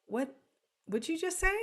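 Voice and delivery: puzzled student, rising sharply on "say"; confused student. Acted. Student not following the lecture, confused and questioning. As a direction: question it as if completely lost. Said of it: "a student is like".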